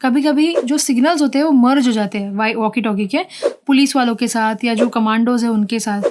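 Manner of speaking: ordinary talk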